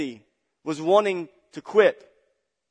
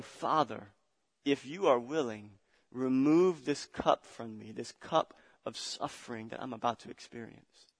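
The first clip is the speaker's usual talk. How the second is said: hushed prayer; 3 semitones lower, 6 dB quieter than usual. Acted. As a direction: pray it gently, almost under the breath. Jesus praying in the garden.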